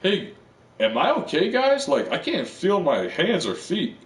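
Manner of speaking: high, strained worried calling out; severed head asking the bikers if he's okay, high and worried; bewildered plea. Retold or imitated. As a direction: ask the guys up high, confused and scared. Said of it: "he's like".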